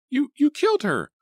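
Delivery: high, loud, stammering accusation; high furious accusing shout. A narrator's reading. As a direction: shout the blame at him. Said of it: "Artie shouted".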